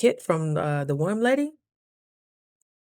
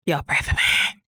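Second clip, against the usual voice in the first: breathy whispered plea; 4 dB louder than usual. Real, not acted.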